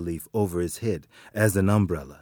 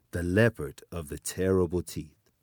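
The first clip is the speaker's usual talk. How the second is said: ordinary delivery, nothing marked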